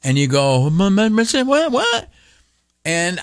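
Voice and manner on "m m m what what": much higher, stuttered disbelieving what; stammering shock. Retold or imitated. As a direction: sputter in alarm. Shocked by blood pressure news.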